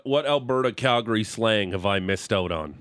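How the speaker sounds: usual speaking voice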